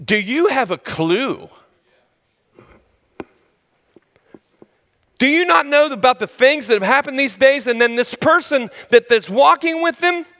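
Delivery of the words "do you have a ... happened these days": high, rising disbelief with NOT pushed; a disciple scoffing at the stranger, incredulous; incredulous, exasperated. Retold or imitated. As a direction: do you have a clue, said with an eye roll. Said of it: "It's like saying".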